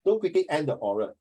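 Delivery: ordinary talk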